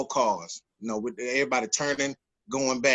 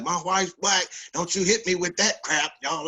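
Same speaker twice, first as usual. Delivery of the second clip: high-pitched indignant cop impression; retold fussing; 5 semitones higher than usual. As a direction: mimic an officer's irritated retort. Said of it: "He started fussing now".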